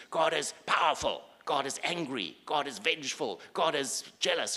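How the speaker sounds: loud, high, punched declarations; acted booming pronouncements, hard on each last word; thunderous proclamation. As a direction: hurl each attribute out like a threat. Ancients' fearsome idea of God.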